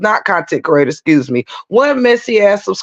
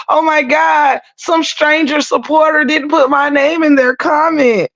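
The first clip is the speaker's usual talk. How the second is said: high, mocking sob-voice whine, much higher; mock crying whine; 8 semitones higher than usual. Streamer mocking a subscriber as a crybaby, high mock whine. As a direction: mimic a petty crybaby in a high wail. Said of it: "like I'm about to cry".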